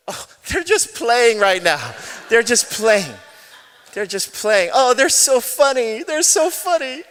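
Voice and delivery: imitated mother's fake cheer, high and loud; mom covering up. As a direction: gush brightly to save face before a stranger. Mom covers up fight for stranger.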